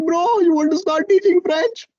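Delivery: high, drowsy imitation of a friend; sleepy voice. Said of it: "he was in a very sleepy voice".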